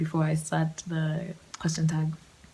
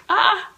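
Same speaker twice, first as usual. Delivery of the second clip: high, louder panicked ah; panicked squeak; 13 semitones higher, 7 dB louder than usual. Retold or imitated. Vlogger voicing her inner panic with a loud yelp. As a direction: yelp in alarm.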